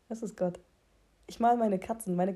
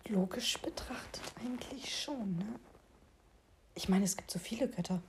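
leise geflüstert; verschwörerisch flüstern; flüsternd; 8 dB quieter than usual. Real, not acted. Plötzlich geflüstert. (geflüstert)

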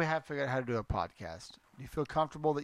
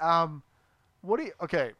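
ordinary talk

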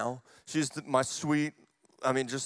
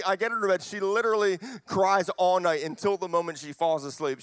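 ordinary talk, nothing marked

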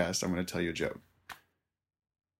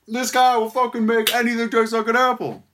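much higher, loud, amazed bro voice; hyped bar guy; 14 semitones higher, 6 dB louder than usual. Acted. Bar patron telling the newcomer about the apple, hyped. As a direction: sell it like the most incredible thing ever.

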